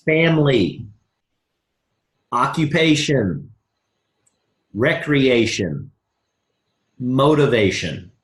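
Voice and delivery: slow, spaced list. Shown as a demonstration. Coach dictating the acronym list, slow. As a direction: lay each word down for notetaking. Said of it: "Write this down on your notes".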